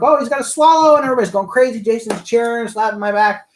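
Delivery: high-pitched, carried away by the memory; excited retelling. Retold or imitated. Reliving the crowd going crazy.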